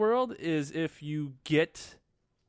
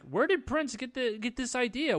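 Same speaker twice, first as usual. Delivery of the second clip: baffled disbelief; 8 semitones higher than usual. Acted. Acting out the baffled audience.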